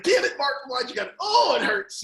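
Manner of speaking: loud pained outburst; mock furious lament; hurt angry widow. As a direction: yell it through the hurt. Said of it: "she was like".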